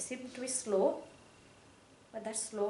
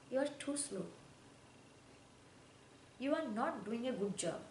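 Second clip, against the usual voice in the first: critical person; 7 dB quieter than usual. Retold or imitated. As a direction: voice the person putting you down. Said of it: "the person said".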